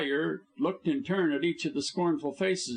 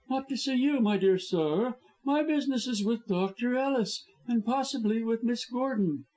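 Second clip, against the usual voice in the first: a lawyer's voice pitched higher, cool and insolent; smug blackmailer; 10 semitones higher than usual. A narrator's reading. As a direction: play the impudent scoundrel.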